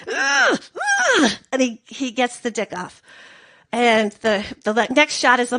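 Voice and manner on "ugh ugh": straining grunts. Pretend. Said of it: "he's like".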